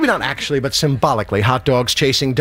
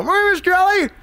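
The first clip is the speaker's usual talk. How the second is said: high, strained chipper reply; squeaky fake-alert greeting; fake-awake answer; faster than usual. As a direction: pretend you've been up for hours. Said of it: "I didn't wake you, did I".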